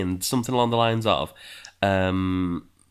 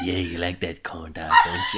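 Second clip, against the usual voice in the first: sexy devil voice; 2 semitones lower than usual. Pretend. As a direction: coax like a tempting devil. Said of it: "your devil voice is sexy".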